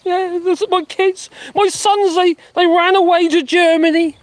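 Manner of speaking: high-pitched mock blubbering; Farage crying. Streamer mocking Farage crying over his family, mock tearful. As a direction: put on a whiny, hammed-up sob. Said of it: "How long before Farage starts crying".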